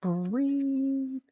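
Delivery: high, loud, very slow brain voice; brain's voice. Retold or imitated. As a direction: drag it out like a desperate brain. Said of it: "My brain's going".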